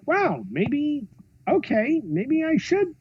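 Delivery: high, hesitant, dawning realisation; retold tentative wonder. Director won over by fans' love, retold tentative wonder. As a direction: think it over aloud in a high voice.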